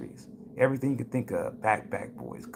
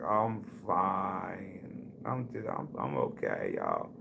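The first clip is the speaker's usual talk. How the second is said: hushed, sinking far lower; whispered stoned reply; 4 semitones lower, 8 dB quieter, much slower than usual. Retold or imitated. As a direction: whisper it, fading down. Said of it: "I was like".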